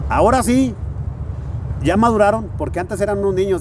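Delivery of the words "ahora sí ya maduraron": louder, higher quoted stage shout; anuncio desde el escenario. Retold or imitated. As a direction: call it out to a crowd. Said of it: "les dijo". (grito citado al público)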